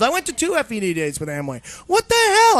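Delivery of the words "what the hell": much higher, loud outburst; outraged. Real, not acted. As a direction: shout it in disbelief. Ranting about own Amway past.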